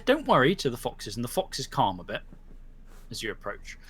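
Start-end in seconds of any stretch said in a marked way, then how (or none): 0.0-0.5 reassuring. Acted. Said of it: "She says".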